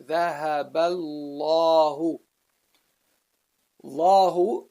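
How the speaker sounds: "ذهب الله" with ba joined straight to a heavy lam; bridged heavy lam. Shown as a demonstration. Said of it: "jump from the ba to the lam right away".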